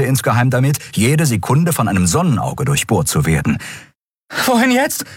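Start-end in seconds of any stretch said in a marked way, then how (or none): 4.5-5.0 high, hurried question between breaths. Acted. Endriel asking Kai where to flee, breathless. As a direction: gasp the question while running. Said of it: "fragte sie und rang nach Atem".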